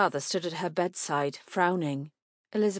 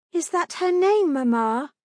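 put-on high, louder child's voice; young Jane; 11 semitones higher, 7 dB louder than usual. A narrator's reading. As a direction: ask like an eager little girl. Young Jane asks her mother.

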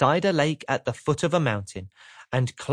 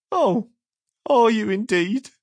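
high, sniffy, unimpressed; 8 semitones higher, 3 dB louder than usual. Acted. Mister Miserable sniffs doubtfully at Mister Happy. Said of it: "sniffed the person".

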